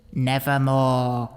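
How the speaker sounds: a hint of a slow, croaking raven; lightly voiced raven, drawn out; raven's reply. A narrator's reading. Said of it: "Then the bird said".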